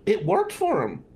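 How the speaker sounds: ordinary talk, nothing marked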